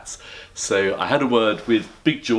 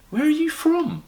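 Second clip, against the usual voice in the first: Mrs Brown high; 3 dB louder than usual. Acted. Mrs Brown asks bear.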